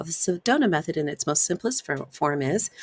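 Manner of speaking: usual speaking voice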